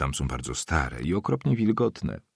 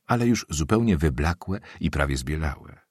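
plain reading voice

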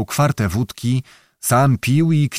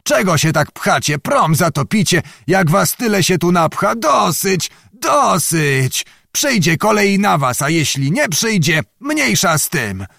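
put-on old man shouting at the crowd from the ferry; yelling; 5 semitones higher than usual. A narrator's reading. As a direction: roar them back off the ferry. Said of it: "zaraz począł znowu wrzeszczeć".

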